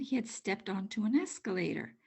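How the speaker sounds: plain reading voice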